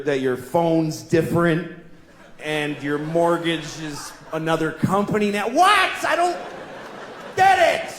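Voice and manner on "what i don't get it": exasperated outburst. Acted. Reading a mortgage letter, exasperated shout. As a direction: blow up in confused frustration.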